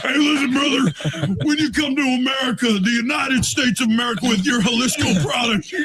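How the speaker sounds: high, shouted Hulk Hogan-style promo; wrestling promo. Pretend. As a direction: bellow it like a pro wrestler hyping the crowd. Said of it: "you're about to cut a wrestling promo".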